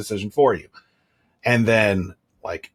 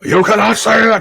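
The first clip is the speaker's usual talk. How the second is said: much higher, much louder scold; mock angry yell; 12 semitones higher, 9 dB louder than usual. Father showing how he'd yell at his daughter, mock loud scold. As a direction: snap it like a furious parent. Getting super mad at her.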